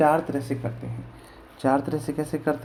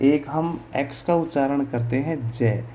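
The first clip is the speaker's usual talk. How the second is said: usual speaking voice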